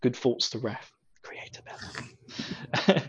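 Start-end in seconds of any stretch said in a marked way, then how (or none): 1.2-3.0 slow hushed whisper. Pretend. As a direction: breathe it reverently, very slow. Reverent whispered title.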